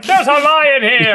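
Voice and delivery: high, loud, drawn-out cry; mock panicked yell. Testing an accent in a lion's den, mock panicked yell. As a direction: holler it in comic alarm.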